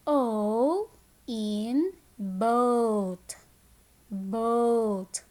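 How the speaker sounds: O and boat stretched very slowly; slow phonics modelling, vowel held long in "boat"; long O demonstration. Shown as a demonstration. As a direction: draw out the O in "boat". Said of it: "long sound of the letter O".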